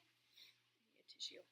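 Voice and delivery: very soft unvoiced mutter; whisper. Real, not acted. Vlogger tearing up, reaching for a tissue, whispered. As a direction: whisper it under your breath. Teary aside while fetching tissue.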